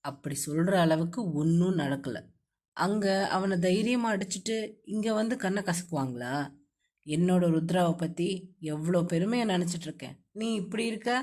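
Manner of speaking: low male voice, mild chiding; young man chiding. A narrator's reading. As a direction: tease her into courage in a low voice. Hero chides her.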